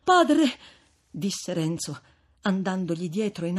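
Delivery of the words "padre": high loud plea; pleading young man. Acted. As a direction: call after him begging. Renzo supplica il frate. (Renzo che supplica, acuto)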